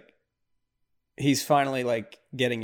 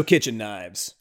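ordinary talk

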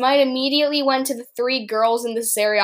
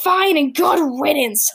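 louder, higher brush-off; yelled; 5 semitones higher, 4 dB louder than usual. Acted. The princesses yelling at Horatio as he leaves, louder and higher. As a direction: snap it out to be rid of him. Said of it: "Luna Lily and Aster yelled at".

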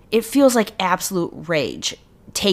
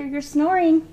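pet talk; 9 semitones higher than usual. Real, not acted. Telling dog off.

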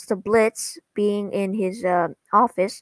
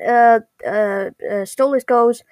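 ordinary delivery

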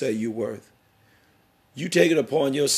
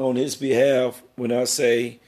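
usual speaking voice

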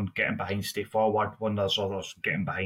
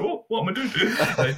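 laughing; 11 semitones higher, 5 dB louder than usual. Retold or imitated. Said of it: "You're like".

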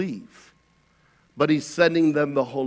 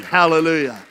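usual speaking voice

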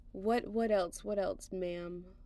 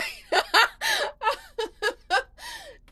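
loud high laugh; 9 dB louder than usual. Real, not acted. Laughs at own pitch.

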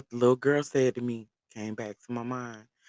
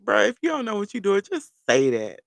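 high, loud, half-laughing complaint; amused exasperation; 9 semitones higher, 7 dB louder than usual. Retold or imitated. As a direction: call him out, loudly and pitched up. Retold exasperation after laughing.

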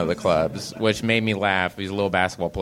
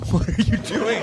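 laughing disbelief; 10 semitones higher than usual. Retold or imitated. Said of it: "Kristen and I were like".

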